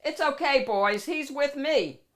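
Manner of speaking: dog boss waving his bouncers aside. Acted. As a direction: call off the guards like the boss.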